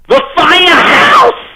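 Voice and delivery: high, slow, drawn-out hype; excited shout. Real, not acted. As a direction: announce it like a big win. Excited announcement of gig.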